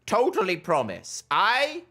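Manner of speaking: sweet-talking toad. Acted. Toad reassures after command.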